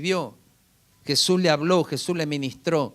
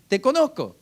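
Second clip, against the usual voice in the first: higher-pitched mock claim to know someone; mocking know-it-all; 6 semitones higher than usual. Preacher imitating presumptuous people for the congregation, mocking know-it-all. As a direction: parrot a smug know-it-all.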